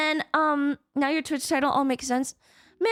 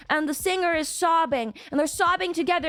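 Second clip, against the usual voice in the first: ordinary talk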